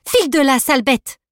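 high and loud, scolding the beast; shouted threat at a dog; angry shout. Acted. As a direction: chase it off, commanding. Girl shouts at charging dog.

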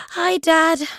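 sleepy yawned greeting; yawning. Acted. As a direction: say it through a yawn. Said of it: "stifling a yawn".

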